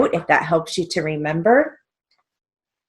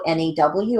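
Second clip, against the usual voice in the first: ordinary delivery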